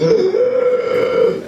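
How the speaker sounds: sharp, high, loud gasp for air. Pretend. Stabbing himself with an EpiPen, big gasp. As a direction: gasp like the EpiPen just hit your heart. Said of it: "and be like".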